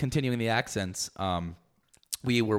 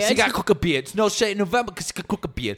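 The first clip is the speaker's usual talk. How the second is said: put-on higher-pitched English accent; playful high English accent; mock English accent; 6 semitones higher than usual. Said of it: "make up with the English accent".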